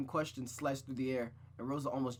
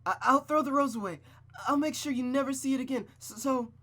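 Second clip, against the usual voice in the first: high, loud, stammering panic; panicked promise; 11 semitones higher, 8 dB louder than usual. Acted. Rosa promising her mother to throw the rose away, panicked. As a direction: blurt it out in terror.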